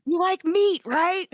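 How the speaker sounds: shouting. Acted. Shouting across to Ogre.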